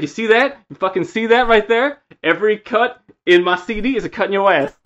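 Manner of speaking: high, loud threatening boast; menacing drunk boast. Retold or imitated. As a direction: threaten with a grin. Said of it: "He's like".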